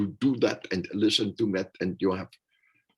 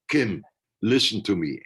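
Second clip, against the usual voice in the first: louder and higher, firm call to listen; raised voice for attention; 4 semitones higher, 6 dB louder than usual. Shown as a demonstration. Speaker demonstrating a raised voice to Kim, louder and firm. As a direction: raise it just enough to be heard. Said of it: "raising the voice for a couple seconds".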